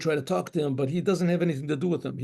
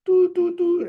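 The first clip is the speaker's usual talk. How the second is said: three high, loud hoots; imitated broken shofar notes; shvorim shofar calls; 13 semitones higher, 7 dB louder, slower than usual. Shown as a demonstration. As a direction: break the horn call into three.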